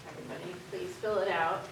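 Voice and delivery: ordinary delivery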